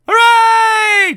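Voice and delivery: very high, loud hooray; cheering. Acted. The crowd cheering Mister Brave, very high and loud. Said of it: "cheered the crowd".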